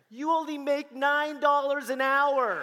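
high, loud and slow disbelief; wife on the phone, stunned, high and loud; incredulous wife. Retold or imitated. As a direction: stretch it out, stunned. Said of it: "She goes".

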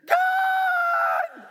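shrill, loud panicked scream of a crowd. Retold or imitated. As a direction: shout it in alarm. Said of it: "everyone around screams".

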